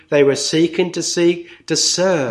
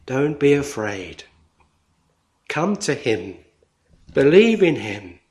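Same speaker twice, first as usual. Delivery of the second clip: much softer and lower, gentle invitation; tender appeal; 5 semitones lower, 4 dB quieter than usual. Acted. Preacher inviting seekers to come to Jesus, soft and tender. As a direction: coax them in softly. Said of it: "appeal to seekers".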